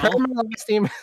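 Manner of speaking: high-pitched American corporate voice; mock passive-aggressive American office boss; American middle-manager accent. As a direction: put on an annoying American manager voice. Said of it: "that's my American middle manager accent".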